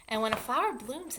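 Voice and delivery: ordinary delivery, nothing marked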